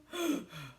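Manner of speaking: tired wolf gasping for air; wheezing gasp. Acted. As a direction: gasp as if worn out. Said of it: "puffing and wheezing".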